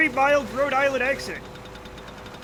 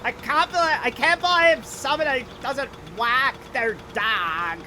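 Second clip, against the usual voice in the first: exaggerated flat-voweled American accent; mock how outsiders' accent sounds, stretching the vowels; hammed-up American accent parody; mocking others' accent. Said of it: "That's how you motherfuckers sound to me".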